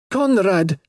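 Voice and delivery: high-pitched desperate wail. A narrator's reading. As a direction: cry out his name in anguish. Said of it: "wailed the girl".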